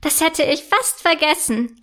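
usual speaking voice

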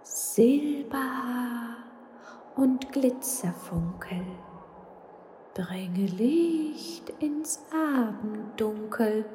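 very slow, soft whispered incantation; mysterious ghost spell. Acted. Ghost casting a magic spell, very slow and whispered. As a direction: chant a secret spell into the fog. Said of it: "schuhute geheimnisvoll einen Geisterzauberspruch".

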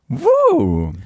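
hoher, lauter Jubelruf; jubelnder Ausruf. Real, not acted. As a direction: jubel kurz hoch hinaus. Jubel über den Klappentext. (hoher Freudenruf)

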